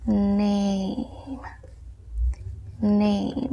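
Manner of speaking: "Name" drawn out softly, one long vowel; soft, lengthened model of "Name"; slow pronunciation demo. Shown as a demonstration. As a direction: stretch "Name" slowly for learners, silent final e. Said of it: "De esta manera".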